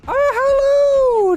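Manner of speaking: high delighted gasping oh; surprised gasp. Real, not acted. Player spotting tiny soldiers, a high surprised oh. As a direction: gasp at the sight. Sees little soldiers, gasps.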